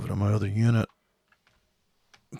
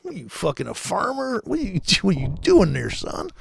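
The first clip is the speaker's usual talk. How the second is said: mock incredulous; 6 semitones higher than usual. Mocking a trader as farmer.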